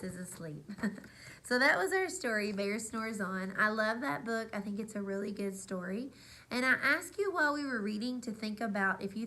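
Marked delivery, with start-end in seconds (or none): none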